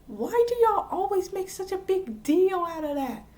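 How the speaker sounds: high-pitched, hammed-up complaint; mock exasperation. Viewer complains to shows about a silly trope, mock exasperation.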